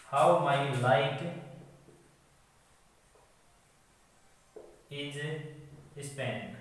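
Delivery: slow, word-by-word dictation with long pauses. Shown as a demonstration. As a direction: dictate it piece by piece while writing it out. Writes the poem line while saying it. (धीरे-धीरे, रुक-रुक कर इमला बोलते हुए)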